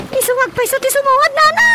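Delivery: building from plain to a loud high cry; rising agitation. Acted. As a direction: climb into a shout on Nana. Daughter getting worked up.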